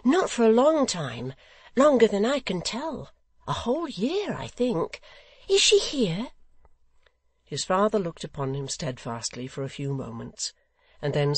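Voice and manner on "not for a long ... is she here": high, loud, eager innocent; eager, high-voiced simple son. A narrator's reading. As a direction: pitch it up with childlike eagerness. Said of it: "cried Barnaby eagerly".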